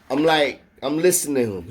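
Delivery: plain reading voice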